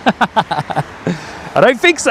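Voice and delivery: slow, laughing through the words. Real, not acted. Streamer laughing at peeling a mandarin before landing, amused. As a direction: chuckle it out, slowed right down.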